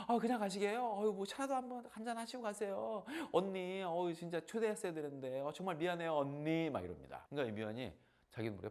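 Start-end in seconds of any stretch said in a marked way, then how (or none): 0.1-6.7 high, eager, gushing invitation; mother gushing at the downstairs neighbor; gushing friendly neighbor. Retold or imitated. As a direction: coax her to stay for tea.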